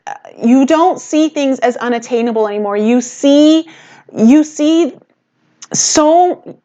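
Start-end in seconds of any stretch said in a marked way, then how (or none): 2.8-6.6 jittery, excited rush; buzzing with nervous energy. Real, not acted. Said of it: "I'm sorry that I'm so jittery".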